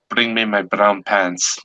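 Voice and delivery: slow, deadpan command; measured captain, unhurried delivery; solemn captain's order. Acted. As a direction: stretch out the punchline order. Joke captain's punchline order.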